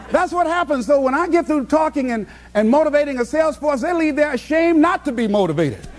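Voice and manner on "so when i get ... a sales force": ordinary talk, nothing marked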